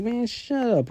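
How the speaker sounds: high snippy put-down; half-hearted annoyed high voice; put-on irritated voice. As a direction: snap it in a voiced-up villain tone. Shigaraki snaps, far timbre.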